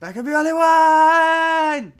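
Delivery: very high, loud shrieking parody. Retold or imitated. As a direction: shriek it slowly, holding the last word. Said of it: "do it like [name]".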